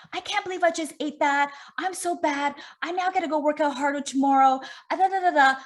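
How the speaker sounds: high-pitched mock self-scolding; self-bashing inner voice. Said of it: "All this bashing that's going on".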